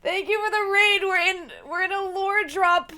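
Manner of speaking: high, loud and buzzing with excitement; excited. Real, not acted. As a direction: gush like your mind is blown. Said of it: "my brain is exploding".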